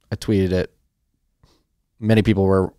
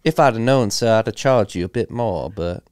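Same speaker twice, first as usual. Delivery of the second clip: hammed-up Southern accent; 3 semitones higher than usual. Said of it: "I don't do accents".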